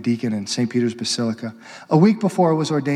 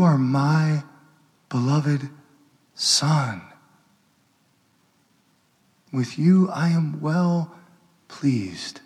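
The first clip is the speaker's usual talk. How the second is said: voice of the Father; much slower than usual. Acted. The Heavenly Father blessing Joseph, slow and tender. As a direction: let each word land gently, like a blessing. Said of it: "heard our Heavenly Father say".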